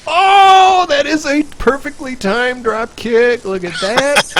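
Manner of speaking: high, loud, drawn-out fan excitement; marking out. Real, not acted. Gamer celebrates a perfectly timed drop kick, marking out. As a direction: explode with thrilled hype, stretching the words. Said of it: "listening to you just mark out".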